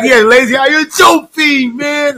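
高くゆっくり怒鳴る; 一語ずつ引き伸ばして罵る; imitating T, slow shout. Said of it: "he sounded like Chris Tucker".

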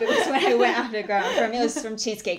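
loud laughing protest. Real, not acted. Laughing defence.

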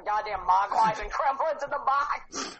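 usual speaking voice

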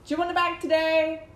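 high, loud customer-service voice; cashier patter. Acted. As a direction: chirp it at a shopper. Acting out own cashier patter.

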